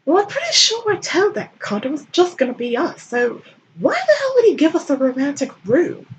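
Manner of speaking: high put-on voice, annoyed; Baring complaining about the room, high and annoyed; indignant Baring voice.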